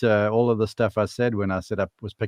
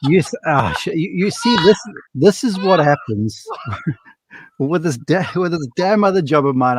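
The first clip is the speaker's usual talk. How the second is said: laughing; 5 semitones higher, 3 dB louder than usual. Real, not acted. Laughing while flustered.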